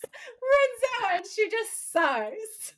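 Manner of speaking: very high, squeaky with laughter; stretched-out giggly words; laughing while talking. Real, not acted. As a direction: tell it while cracking up. Cracks up retelling the exit.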